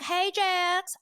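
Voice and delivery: high, loud Southern belle twang; hammed-up Southern drawl, high and loud. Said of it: "a twang. She's like".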